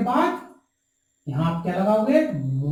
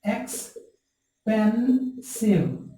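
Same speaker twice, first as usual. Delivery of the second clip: slow syllable-by-syllable word; 3 semitones higher, much slower than usual. Shown as a demonstration. Teacher to students, modelling expensive slowly and drawn out. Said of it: "इस तरह से".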